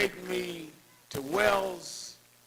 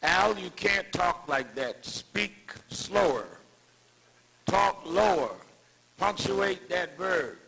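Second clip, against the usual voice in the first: higher, emphatic teacher correcting a pupil; Doctor Shabazz grilling; 3 semitones higher, 3 dB louder, slower than usual. Retold or imitated. Mentor drilling Al on how to speak, lightly imitated grilling. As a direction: lightly imitate a strict coach, spacing out each instruction. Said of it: "what she would do is grill me".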